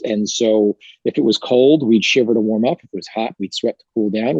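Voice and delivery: usual speaking voice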